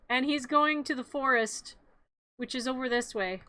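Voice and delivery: usual speaking voice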